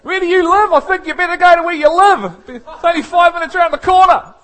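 loud, high, fast sarcastic dismissal; a laughing hammed-up get-out; mock brush-off. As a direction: shoo the annoying member out. Mocking pushing someone out.

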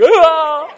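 high, loud, laughing mock scream. Retelling the ride, a laughing mock scream. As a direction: shriek it in fun, half laughing. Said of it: "I'll be".

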